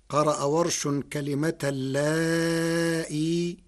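usual speaking voice

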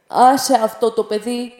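growled threat. Acted. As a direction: growl it like a hulking porter. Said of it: "μούγκρισε πάλι".

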